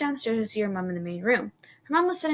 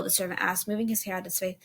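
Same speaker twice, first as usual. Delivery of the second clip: usual speaking voice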